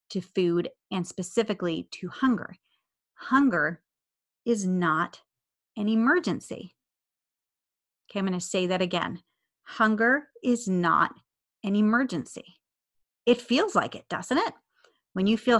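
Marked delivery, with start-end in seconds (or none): 3.1-6.7 "Hunger... is not... an emergency", a pause after each part; spaced-out, deliberate emphasis on "Hunger is not an emergency"; emphatic key message. Real, not acted. 9.6-12.6 "Hunger is not" drawn out slowly, pause, then "an emergency"; slow, deliberate "Hunger is not... an emergency"; emphatic repeat. Real, not acted. Key line repeated for emphasis.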